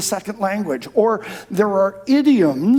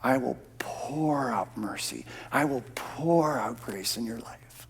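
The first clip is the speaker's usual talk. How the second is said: God's gentle promise; 6 semitones lower, 10 dB quieter than usual. Retold or imitated. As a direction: hush it like a tender secret. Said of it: "God says".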